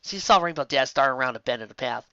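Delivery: usual speaking voice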